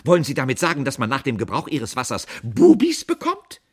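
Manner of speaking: loud, high, outraged interrogation; exploding. A narrator's reading. Aunt outraged at the product name, exploding. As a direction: let loose in loud indignation. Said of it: "brach sie los".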